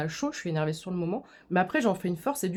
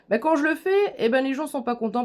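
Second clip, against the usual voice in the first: 1 exasperated outburst; 6 semitones higher, 5 dB louder than usual. Real, not acted. Exasperated answer to a hater.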